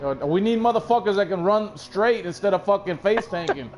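ordinary talk

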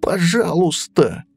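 high pleading piglet voice. Acted. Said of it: "умолял маленький поросёнок".

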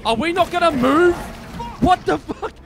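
loud, high-pitched disbelief; exasperated outburst. Real, not acted. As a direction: cry out in frustrated disbelief. Exasperated at a stalled game character.

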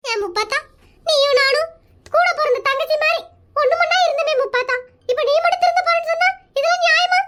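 put-on weepy appeal, pitch climbing; guilt-trip a friend with sham tears; tearful mock pleading; fake crying. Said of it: "நீ அழுதுட்டு இருக்கிற".

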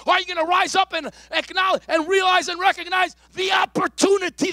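loud, high preaching cry; high, loud sermon delivery; full-force preaching. Acted. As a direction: preach it to the rafters.

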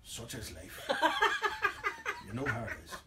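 low, sighing, resigned "Such is life"; mock resignation. Winding up small talk with a melter, resigned sigh. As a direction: let it fall with a weary sigh. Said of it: "Big inhale".

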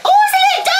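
very high loud shriek. Real, not acted. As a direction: squeal in fright. Scared high shout.

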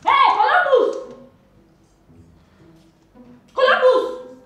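high, loud, drawn-out call for Columbus; angry yell. Acted. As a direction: bellow his name across the yard. Mother calling child to punish.

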